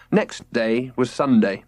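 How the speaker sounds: ordinary delivery, nothing marked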